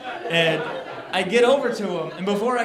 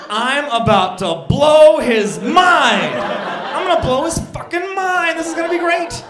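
much higher and louder, slow and gloating; gleeful excitement; 10 semitones higher, 10 dB louder than usual. Retold or imitated. About to surprise the sandwich guy, gleeful high crowing. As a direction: crow it like a kid with a secret.

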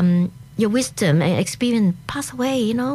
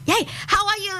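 high, excited mock greeting; too excited; 10 semitones higher than usual. Imitating Chinese friends chatting at an event, overexcited. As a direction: imitate chatty friends squealing hello, pitched well up. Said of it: "too excited".

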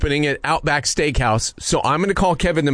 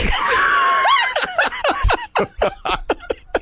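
a long high cackle of laughter. Real, not acted. Prank caller losing it at his own accent, high laughing. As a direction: burst into shrieking laughter.

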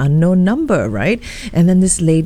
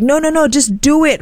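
voiced higher, a pushy urgent wife; 8 semitones higher than usual. Wife urging the girlfriend to inject, higher and urgent. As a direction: push her to act now. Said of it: "And she was like".

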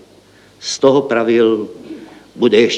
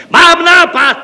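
much higher, louder burst of joy; joyful shout; 8 dB louder, slower than usual. A narrator's reading. Deputy clerk bursting in with an idea, joyful shout. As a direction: blurt the idea out in delight. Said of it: "vykřikl radostně zástupce stavebního referenta".

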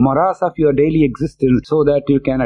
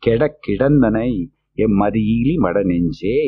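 verse recital; 5 semitones lower, much faster than usual. Acted.

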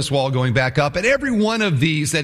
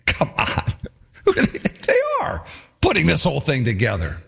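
derisive; 3 semitones lower than usual. Acted. Sanballat deriding the builders' ambition, scornful. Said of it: "He derides their ambition".

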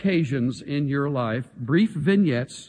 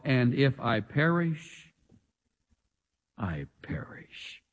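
whispered resolve; 4 semitones lower, 6 dB quieter, slower than usual. Retold or imitated. Esther's resolve, voiced softly.

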